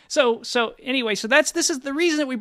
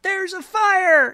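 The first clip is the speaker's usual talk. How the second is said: high, loud, slow drawn-out alarm shout; alarmed yell; 11 semitones higher, 7 dB louder, much slower than usual. Retold or imitated. Guy across the river warning them of the fire, high loud yell. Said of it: "I heard him yelling like".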